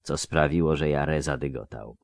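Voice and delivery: ordinary delivery, nothing marked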